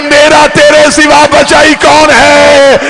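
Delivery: loud, high, very slow wailing cry; anguished wailing son's cry. Acted. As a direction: sob out the words at full voice. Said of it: "रो के कहा".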